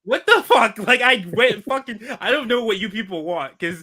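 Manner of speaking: high, loud outburst of exasperation; fed up with impossible critics; exasperated protest. Real, not acted. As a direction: protest in high-pitched disbelief. Exasperated protest at contradictory feedback.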